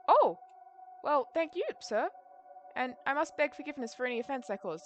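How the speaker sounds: put-on high, small old man; old man voice for a little kid. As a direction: thank him like a little old fellow. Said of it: "I went for old man".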